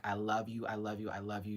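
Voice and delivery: tender, fading I love you; soft tender. Real, not acted. Tender sign-off to fans.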